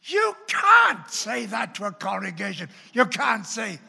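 loud shouting exclamation; emphatic shout. Real, not acted. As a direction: shout it out in disbelief. Preacher exclaims at congregation.

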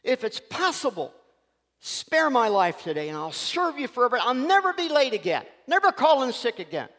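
high, pleading mouse cry to God; frantic prayer. Acted. The starving mouse begging God to spare him, in a high frantic plea. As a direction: beg for your life, voice up high. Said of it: "He's frantic and he's crying out".

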